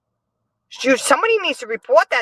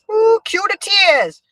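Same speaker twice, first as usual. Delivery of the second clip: mock cue; 11 semitones higher, 3 dB louder, slower than usual. Mocking the crying on cue.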